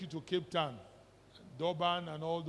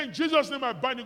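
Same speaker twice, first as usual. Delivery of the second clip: higher, louder binding command; mock deliverance shout; 6 semitones higher, 9 dB louder than usual. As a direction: bark it like a deliverance minister. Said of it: "You know they talk like this".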